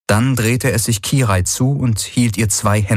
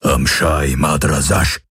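low, slow booming alien voice; thundering creature; 5 semitones lower, slower than usual. A narrator's reading. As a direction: rumble like surf. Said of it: "wie das Donnern der Meeresbrandung".

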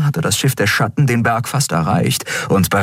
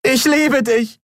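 high-pitched heartfelt cry; high, desperate call; slower than usual. A narrator's reading. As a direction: call it out to someone being left behind. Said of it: "rief sie".

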